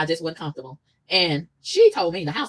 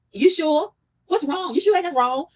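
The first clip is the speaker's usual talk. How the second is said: mock prying concern; 9 semitones higher, 3 dB louder, slower than usual. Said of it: "mocking the prying questions".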